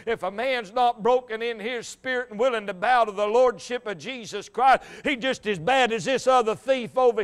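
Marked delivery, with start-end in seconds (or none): none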